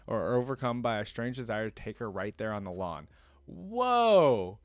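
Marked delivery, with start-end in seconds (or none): 3.5-4.6 high, loud, long falling exclamation; astonished whoa. Real, not acted. Reader reacting to the man's bold request, loudly astonished. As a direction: blurt out a drawn-out playful shock.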